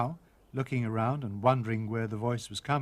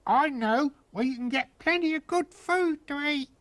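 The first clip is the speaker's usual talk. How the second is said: tiny Tom; 13 semitones higher, 4 dB louder than usual. A narrator's reading. Tom bargaining from inside wolf.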